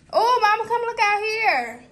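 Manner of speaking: loud call across the house. Real, not acted. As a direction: shout for Mama to come. Calling mother from another room.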